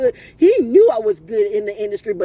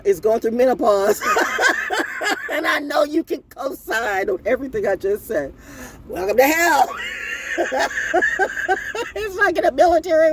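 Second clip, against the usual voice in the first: high bursts of laughter between phrases; laughing hard; 5 semitones higher than usual. Real, not acted. Welcoming menopause families to hell, real helpless laughter. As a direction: crack up while getting the words out. Cracks up joking about menopause.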